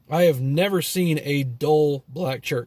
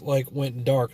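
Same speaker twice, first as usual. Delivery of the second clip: ordinary delivery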